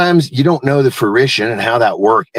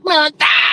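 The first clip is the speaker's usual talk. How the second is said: startled yelp. Pretend. Startled jump-scare opening.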